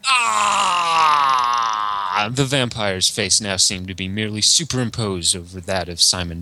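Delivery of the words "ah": loud, high anguished cry; pained vampire cry. Acted. Dracula stung by the duck joke, loud anguished cry. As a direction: howl as if hit.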